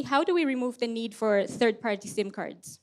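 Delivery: normal talking voice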